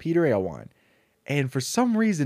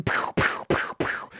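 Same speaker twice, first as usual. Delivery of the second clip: breathy unvoiced "pew pew" gun noises; whispered gunshots; 5 dB quieter, slower than usual. Woody firing from the horse, whispered pew pew. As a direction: shoot with your mouth, kid style. Woody shooting while riding.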